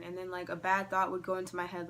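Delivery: ordinary talk, nothing marked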